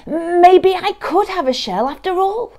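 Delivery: high, loud burst of hope; hopeful brainwave. Acted. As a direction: exclaim it as a bright idea dawns. Norman suddenly has an idea.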